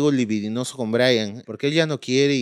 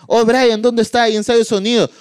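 mock phone call; 9 semitones higher, 5 dB louder than usual.

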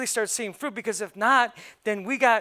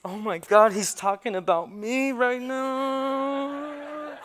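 mock guilty emoji; 5 semitones higher, much slower than usual. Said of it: "this one's like".

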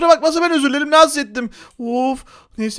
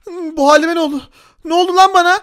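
usual speaking voice

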